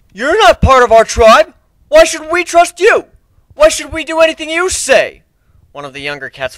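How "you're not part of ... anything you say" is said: put-on a young warrior standing up to the stranger; young tribesman protesting a stranger; defiant young cat. Young cat defies the outsider.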